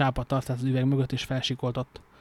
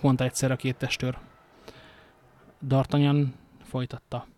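plain reading voice

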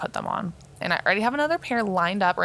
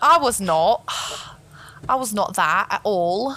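slow, badly faked Scottish accent; attempted Scottish accent. Denying she was a stoner, in a wobbly Scottish accent. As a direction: put on a drawn-out Scottish accent. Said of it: "I don't know what accent that was".

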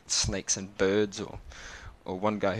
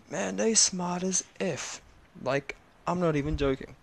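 amazed emphasis; 8 semitones higher than usual. Real, not acted. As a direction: stretch it out in awe. Amazed at the kids.

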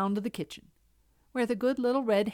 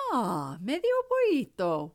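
lightly voiced wind; 3 semitones higher than usual. A narrator's reading. The wind replying to Medio Pollito, lightly voiced. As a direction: let the wind lightly sigh the name.

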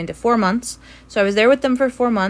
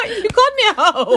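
high-pitched laughing accusation; 3 dB louder than usual. Real, not acted. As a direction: squeal it out through laughter.

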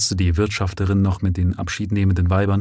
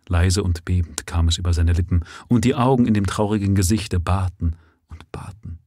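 plain reading voice

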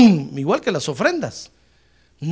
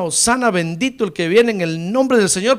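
ordinary talk, nothing marked

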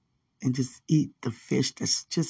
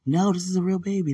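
higher, louder mock protest; retold insistence; 6 semitones higher, 6 dB louder than usual. Mother answering people who think it's a doll, insistent. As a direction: imitate a mother firmly correcting doubters.

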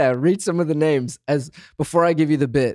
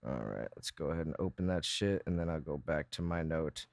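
far off mic; 10 semitones lower, 15 dB quieter, faster than usual. Real, not acted. Speaking off mic.

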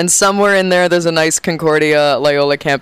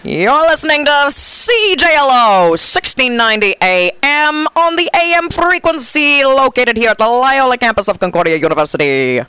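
polished radio announcer, pitched up, ending brisk; station ID read; 7 semitones higher than usual. Shown as a demonstration. Guest reads a station ID on request in announcer voice. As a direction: sell it like the station's own voice. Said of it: "can you do a little station I D".

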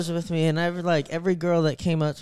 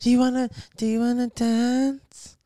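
high-pitched imitated stripper voice; badly put-on girly voice, pitched way up; girl voice imitation; 7 semitones higher than usual. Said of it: "sound like middle schoolers".

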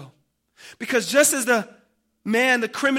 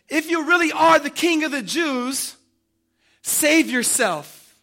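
mocking; 6 semitones higher, 6 dB louder than usual. Soldiers daring the crucified king, mocking. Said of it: "they scoffed at Jesus".

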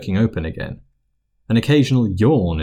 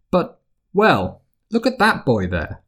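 matron voice; 7 semitones higher than usual. Acted. Poppy points out boy.

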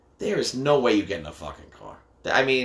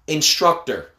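usual speaking voice

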